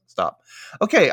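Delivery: usual speaking voice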